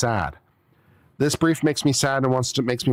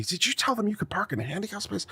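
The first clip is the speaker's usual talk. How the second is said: stern cop; 7 semitones higher, 8 dB quieter than usual. Retold or imitated. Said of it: "the cop comes up to me".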